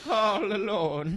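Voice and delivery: high, drawn-out mock wail; hammed-up drunk impression. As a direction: echo the drunk's lament, stretched out. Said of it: "like, the drunk".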